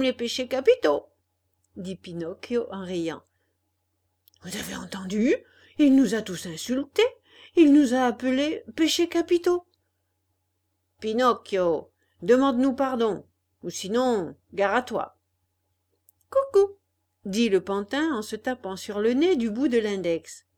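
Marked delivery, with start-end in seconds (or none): 4.4-5.3 hushed whisper; conspiratorial whisper. Acted. Schoolboy whispers to his gang, hushed whisper. Boys whisper about insult. 5.8-15.2 loud, bullying threat; threatening. Acted. Gang of boys threatens the puppet, loud bullying threat. 16.3-16.8 high, loud taunting call; sing-song jeer to provoke them; mocking. Puppet taunts the angry boys, high mocking cuckoo. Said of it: "pour se moquer d'eux".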